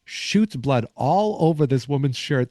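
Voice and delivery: ordinary talk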